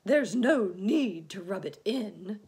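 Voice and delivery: loud, high irritated retort; offended earthworm outburst; snapping. Acted. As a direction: snap back, stretching the words. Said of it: "snapped the earthworm".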